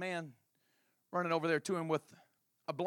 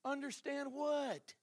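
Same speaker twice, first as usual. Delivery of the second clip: high, slow baffled question; 11 semitones higher, much slower than usual. Acted. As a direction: draw it out in disbelief. Disciple baffled reply.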